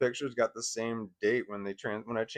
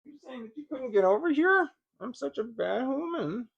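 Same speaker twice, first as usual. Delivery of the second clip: high pet talk; 12 semitones higher, 3 dB louder than usual. Real, not acted. Apologizing to the dog.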